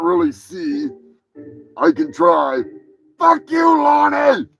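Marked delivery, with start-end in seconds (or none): 3.2-4.5 shout. Acted. Yelling at Lonnie.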